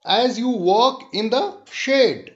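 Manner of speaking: slow recitation of "It tickles my bones"; measured, unhurried poem reading; poem recital. Shown as a demonstration. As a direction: savour each line of the poem for the class. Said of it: "दूसरा स्ट्रंजा है कि".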